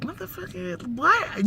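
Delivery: high, stunned what; shocked and thrown by the overshare; stunned disbelief. Retold or imitated. To the neighbor oversharing about his will, stunned disbelief.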